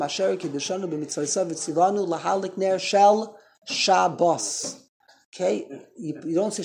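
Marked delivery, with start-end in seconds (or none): 3.7-4.8 "Shabbos" with the weight on the final syllable, sha-BOS; Hebrew last-syllable stress. Shown as a demonstration. Teacher models the word from the candle blessing, stressed on "-bos". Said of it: "in Hebrew it's Milurah".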